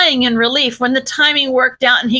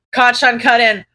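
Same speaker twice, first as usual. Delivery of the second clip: ordinary delivery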